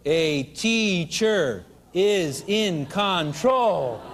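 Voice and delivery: "A teacher is in control" stretched out very slowly; slow reveal. Shown as a demonstration. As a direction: unveil the hidden phrase word by word, amused. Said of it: "Take a look at it real closely, backwards".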